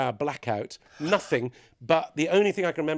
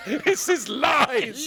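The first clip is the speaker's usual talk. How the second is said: laughing mock protest; 12 semitones higher, 5 dB louder, much slower than usual.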